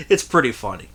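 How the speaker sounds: ordinary talk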